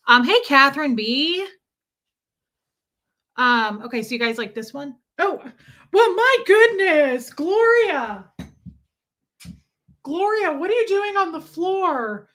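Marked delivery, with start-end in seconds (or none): 5.2-12.3 pet voice. Real, not acted. Talking to pet on floor.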